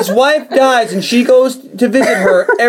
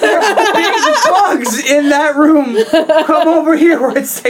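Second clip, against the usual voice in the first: creepy Rocky voice, laughing; 6 semitones higher, much slower than usual. Retold or imitated. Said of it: "He tells her".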